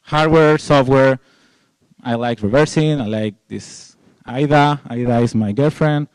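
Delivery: ordinary talk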